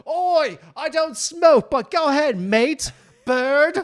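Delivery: loud, high, hammed-up Aussie; fake Australian accent. Faker talking over the loud bar, shouted mock Australian. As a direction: holler it in bad put-on Australian. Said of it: "so I could fake it".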